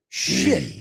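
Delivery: ordinary talk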